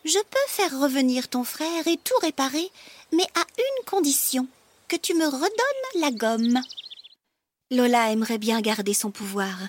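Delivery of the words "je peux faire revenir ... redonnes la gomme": high-pitched little creature; tiny negotiating frog, pitched up; small frog voice. Acted. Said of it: "de sa petite voix".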